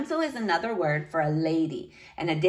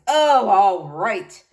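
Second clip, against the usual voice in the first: loud, drawn-out giving in; grudging bark; 5 dB louder, slower than usual. A narrator's reading. Knight giving in to niece, exasperated bark. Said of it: "he barked".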